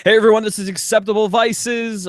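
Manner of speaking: normal talking voice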